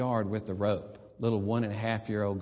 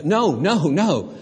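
loud, higher no no no; emphatic insistence; 5 semitones higher, 8 dB louder, slower than usual. Real, not acted. Preacher overruling the bored listeners, loud and firm. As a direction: cut them off, insisting. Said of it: "preacher insists".

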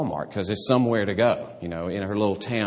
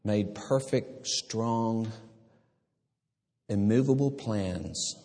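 slow prayer; slower than usual. Real, not acted. Closing prayer.

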